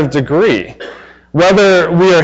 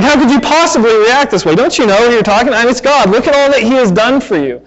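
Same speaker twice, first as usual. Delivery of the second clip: higher, incredulous mock reproach; exasperated; 7 semitones higher, faster than usual. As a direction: plead with disbelief at their ingratitude. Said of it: "it's like".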